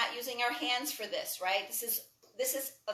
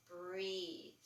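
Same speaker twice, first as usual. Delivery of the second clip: soft cue; 5 semitones lower, 12 dB quieter than usual. Acted. Guiding breath in yoga class.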